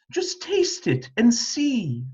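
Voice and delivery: high, coaxing mother; coaxing queen voice. A narrator's reading. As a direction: sweetly coax the child.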